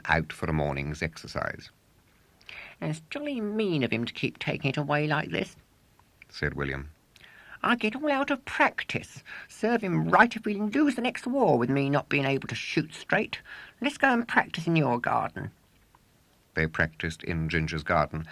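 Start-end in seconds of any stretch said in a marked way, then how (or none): 2.8-5.5 high, sulky schoolboy voice; indignant young William grumbling; boy William complaining. Acted. 7.6-15.5 high, sulky schoolboy voice; indignant young William grumbling; boy William complaining. Acted. William grumbles, proposes practice.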